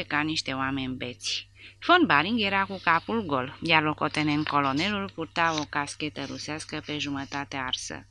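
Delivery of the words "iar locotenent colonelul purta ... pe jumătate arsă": plain reading voice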